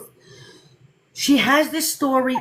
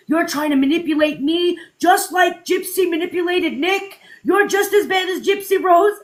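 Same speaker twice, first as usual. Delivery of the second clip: mock fan accusation; 5 semitones higher than usual. Fan accusing the creator of manipulation, shouting high. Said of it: "And she's like".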